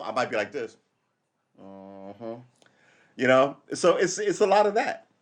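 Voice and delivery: ordinary talk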